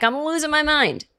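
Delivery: mock exasperation. Shown as a demonstration. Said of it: "do a reenactment".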